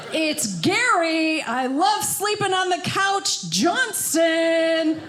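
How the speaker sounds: drawn-out, sing-song boxing announcer call; announcing husband versus wife in the ring. Pretend. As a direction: boom it like a prize-fight intro.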